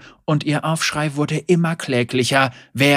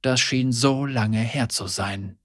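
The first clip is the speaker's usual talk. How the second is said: ordinary delivery, nothing marked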